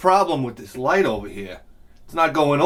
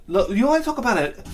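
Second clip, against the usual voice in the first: ordinary delivery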